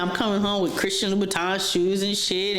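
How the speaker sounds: normal talking voice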